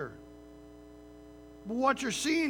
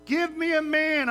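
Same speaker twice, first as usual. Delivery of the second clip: higher, louder mocking challenge; Goliath taunting; 5 semitones higher, 5 dB louder than usual. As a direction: jeer at them across the campfire. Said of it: "Just taunting them".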